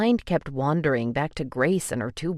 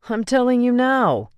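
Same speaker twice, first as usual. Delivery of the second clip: defensive retort; 9 semitones higher, 5 dB louder than usual. Acted. Angel retorting to Sal.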